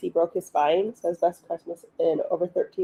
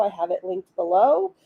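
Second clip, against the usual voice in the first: normal talking voice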